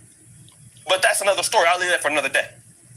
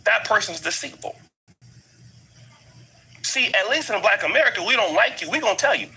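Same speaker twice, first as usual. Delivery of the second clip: ordinary talk, nothing marked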